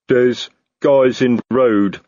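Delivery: slow, loud dim troll; plodding troll reporting. Acted. Troll Detritus voiced.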